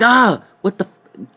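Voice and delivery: high, loud, slow startled cry; mock shock. Both boys stunned by him, high slow startled cry. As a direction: gasp it in fake horror. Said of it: "we're like".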